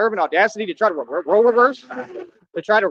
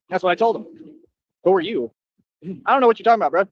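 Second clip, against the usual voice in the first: ordinary talk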